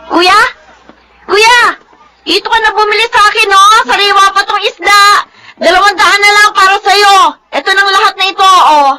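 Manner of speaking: calling out to a buyer. Acted. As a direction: hawk the fish loudly to win the buyer. Fish vendor calling to a passerby.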